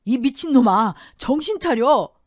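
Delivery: high, loud yell to stop him; alarmed, forceful bellow; angry shout. A narrator's reading. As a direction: shout sense into your brother. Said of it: "아주버님이 막아서며 소리쳤죠".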